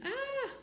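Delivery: very high scream at ordinary volume; mock baby scream. A narrator's reading. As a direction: squeal like a startled baby, without full force. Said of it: "screamed like a baby".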